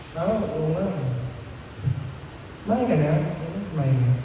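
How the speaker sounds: quiet, bored and flat; uninterested mumble. Shown as a demonstration. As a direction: say it like you don't care at all. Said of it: "sobrang hina, sobrang baba ng tono".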